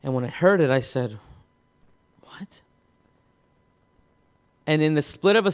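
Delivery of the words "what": breathless stunned whisper; a whispered gasp of shock. Retold or imitated. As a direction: barely voice the word in disbelief. Shocked reaction to accusation.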